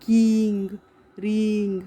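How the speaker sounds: "किंग", "रिंग" with a drawn-out ee. Shown as a demonstration. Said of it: "आई का sound ई आ रहा है".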